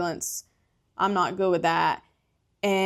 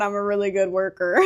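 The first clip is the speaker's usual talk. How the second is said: normal talking voice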